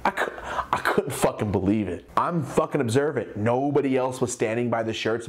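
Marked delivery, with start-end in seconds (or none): none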